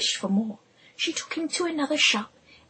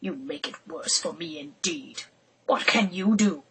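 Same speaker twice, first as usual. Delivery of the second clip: a hint of a surly carter muttering; grumbling carter; 7 semitones lower than usual. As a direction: sneer it under your breath. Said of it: "grumbling to himself".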